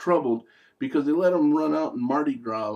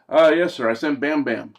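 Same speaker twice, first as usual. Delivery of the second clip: loud, eager reply to the boss; mock dutiful servant; demon underling answering; 4 semitones higher, 7 dB louder than usual. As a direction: report up to your master, raised voice. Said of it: "And he's like".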